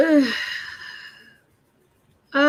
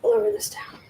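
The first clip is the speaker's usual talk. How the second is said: higher unvoiced whisper; 9 semitones higher than usual. Real, not acted. Whispering while moving the desk. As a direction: whisper it under your breath.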